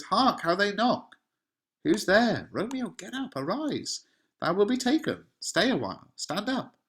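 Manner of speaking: high-pitched, acted panic; panicking. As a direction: call out in alarm, pitch jumping up. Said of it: "is panicking".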